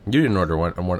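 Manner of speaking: usual speaking voice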